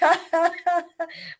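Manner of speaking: short burst of laughter mid-word; sudden laugh. Real, not acted. Reader tickled by the suckling baby line, laughing. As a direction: break off into a chuckle. Reader breaks up at own reading.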